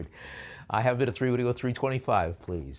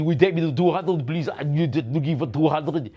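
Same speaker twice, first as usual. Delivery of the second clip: loud put-on voice; 4 semitones higher, 8 dB louder than usual. Put-on bidder voice again.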